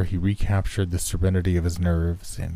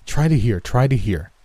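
louder, higher, insistent plea; urgent urging; 4 semitones higher, 4 dB louder than usual. A narrator's reading. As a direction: urge him eagerly to keep trying. Doctor urges chaplain to listen.

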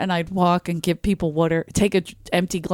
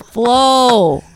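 loud, slightly higher mock answer; emphatic retold answer; 4 semitones higher, 8 dB louder than usual. Retelling the answer to who Flo is, emphatic. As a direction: punch out the name loudly.

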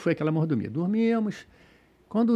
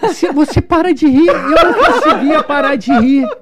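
high, loud, through real laughter; helpless laughing voice; laughing retelling; 8 semitones higher, 8 dB louder than usual. Real, not acted. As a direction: struggle to talk while cracking up. Recalls uncontrollable laughing fit.

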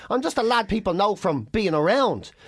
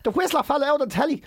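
high, insistent mock disbelief; incredulous fan; 9 semitones higher, faster than usual. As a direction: imitate a fan badgering someone to remember. Fan badgering girlfriend on phone.

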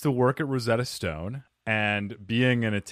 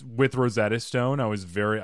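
ordinary talk

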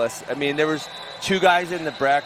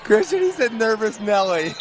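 much higher, delighted quoting; excited, amused retelling; amused outburst; 8 semitones higher, 3 dB louder than usual. Real, not acted. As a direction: blurt it out amused. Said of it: "it was so funny".

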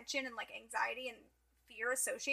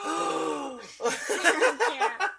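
louder and higher, gasping; shocked gasp; 5 semitones higher, 9 dB louder than usual. Real, not acted. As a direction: gasp then blurt it out. Shocked realization.

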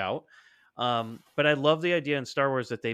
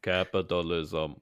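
slow, low mock Russian accent in [name]'s voice; a half-hearted Russian accent, slowed down; 7 semitones lower, slower than usual. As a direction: drag out the word like [name]'s Russian. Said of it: "that was just the [name] voice".

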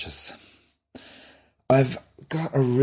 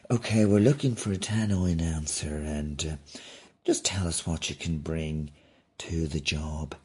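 lightly voiced interviewer, softening and lowering; a quiet, businesslike interviewer's voice; job interviewer; 5 semitones lower, 5 dB quieter than usual. Tesco interviewer questioning a tannoy candidate, lightly voiced interviewer. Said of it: "what's the interview for tannoy announcer".

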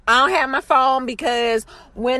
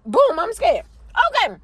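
ordinary talk, nothing marked

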